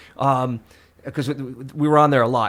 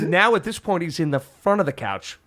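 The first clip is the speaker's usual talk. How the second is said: ordinary talk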